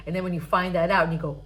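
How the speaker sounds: ordinary talk, nothing marked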